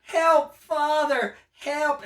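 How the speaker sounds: high, loud mock panic; freaking-out kid. Caged kid rejects the parent, hammed-up freaking out. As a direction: yell for help like a hammed-up scared child. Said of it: "this kid's freaking out like".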